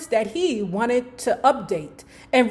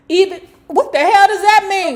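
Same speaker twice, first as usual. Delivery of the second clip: loud, high-pitched burst of disbelief; incredulous yell, pitch jumping up; shocked outburst; 9 semitones higher, 10 dB louder, faster than usual. Real, not acted. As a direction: break off and yell it, baffled. Breaks off reading to react.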